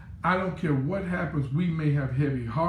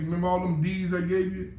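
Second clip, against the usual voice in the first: usual speaking voice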